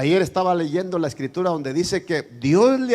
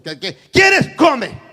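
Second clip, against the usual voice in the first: curt snap; 8 semitones higher, 9 dB louder, slower than usual. Retold or imitated. Curt wife snapping.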